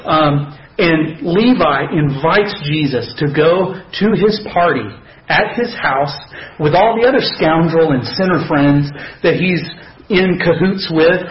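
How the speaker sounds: usual speaking voice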